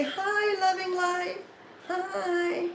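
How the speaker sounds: very high, soft pet's voice; voicing the dog. Owner answering a viewer as her dog, tiny high voice.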